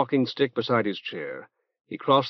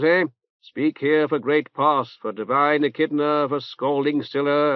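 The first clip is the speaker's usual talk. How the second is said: reverberant nasal voice; 2 semitones higher than usual. Acted. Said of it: "in a reverberant nasal voice".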